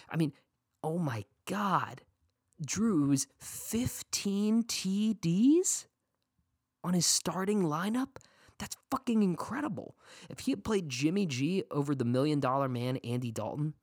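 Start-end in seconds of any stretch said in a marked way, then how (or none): 0.8-10.0 amazed. Real, not acted. Amazed at fifteen touchdowns.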